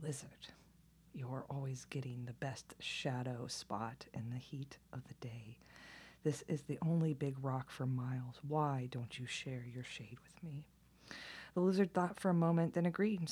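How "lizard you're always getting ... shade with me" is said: a snake's hiss, spat out in a whisper. Acted. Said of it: "Snake spit out his answer from his forked tongue".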